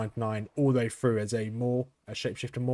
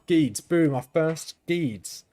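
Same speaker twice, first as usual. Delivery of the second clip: excited; 5 semitones higher, 5 dB louder, much slower than usual. Real, not acted. Excited loot find.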